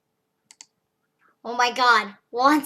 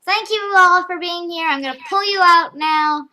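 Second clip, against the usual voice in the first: loud; 5 semitones higher, 7 dB louder than usual. Real, not acted. Wrapping up stream loudly.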